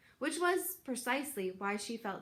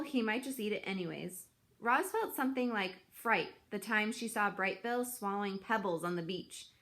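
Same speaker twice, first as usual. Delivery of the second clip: plain reading voice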